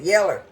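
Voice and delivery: "Yeller" ending on an r, YEL-ler; granny's country pronunciation. Retold or imitated. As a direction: say "Yeller" just as granny did. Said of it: "it's gonna have a R sound on it".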